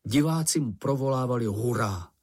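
plain reading voice

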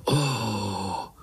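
long awed "oh"; exhaled "oh". A narrator's reading. Spiderlings marvel at uncle's escape, awed sigh. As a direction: breathe out one drawn-out "oh". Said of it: "vydechli pavoučci sborem".

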